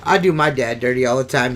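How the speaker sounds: ordinary delivery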